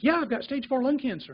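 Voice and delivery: imitating a blithely upbeat patient, pitched high; mismatched cheerful affect. Patient blithely answers doctor about his diagnosis, mock cheerful. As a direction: report it brightly, as if it were good news. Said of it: "Affect is not matching the, uh, diagnosis".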